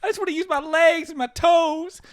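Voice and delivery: put-on very high, loud whining child voice; whiny kid. Son whining to his football dad, high and loud. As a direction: wail it like a little boy begging.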